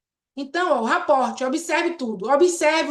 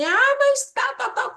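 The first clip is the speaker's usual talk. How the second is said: high, squeaky mock complaint; mocking Lúcia; 11 semitones higher, slower than usual. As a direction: parrot her whining, pitched way up. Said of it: "a Lúcia fala assim". (imitando a Lúcia reclamando, voz bem aguda)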